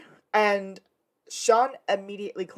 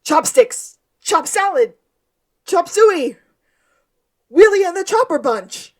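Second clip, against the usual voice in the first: high, loud psychic outburst; screaming freak-out; 11 semitones higher, 11 dB louder than usual. Acted. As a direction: yell each one out like a mock vision. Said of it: "Sean screams".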